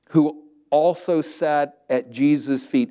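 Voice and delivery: ordinary delivery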